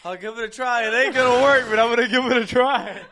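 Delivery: hammed-up resigned vow. Imitating friend's line again.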